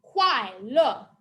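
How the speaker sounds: "Kuai le" chopped downward, loud and firm; dropping tone. Shown as a demonstration. Teacher modelling fourth tone for learners, sharply falling. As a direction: chop each syllable of "Kuai le" down. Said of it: "chopping down with your hand and your voice".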